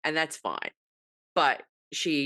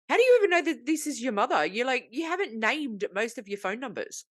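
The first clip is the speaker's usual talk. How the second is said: high incredulous question to the wife; mock disbelief, pitched up; teasing the wife for unnamed numbers; exasperated; 7 semitones higher than usual.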